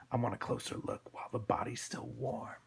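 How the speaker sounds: soft, sinister villain voice; hushed thug menace; menacing thug. Acted. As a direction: say it hushed and creepy.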